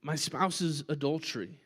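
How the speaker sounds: pleading congregant. Retold or imitated. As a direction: voice a hurting person begging for an answer. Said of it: "You say".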